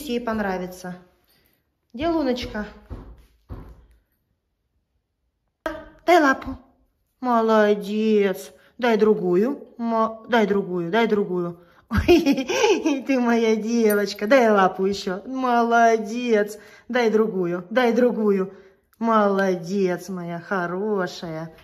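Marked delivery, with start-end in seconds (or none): 1.9-11.6 loud, bright dog commands; talking to a dog. Real, not acted. Dog tricks, give paw. 11.9-14.3 laughing loud pet praise; laughing with the dog. Real, not acted. Dog gives paw, she laughs. 14.3-21.5 loud commands, slow praise; drawn-out praise for the pet; talking to a dog. Real, not acted.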